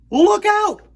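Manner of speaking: high, loud yell; cry of warning. Acted. Peter warns the children of cannon fire, yelling. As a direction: shout the danger to everyone. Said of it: "cried Pan".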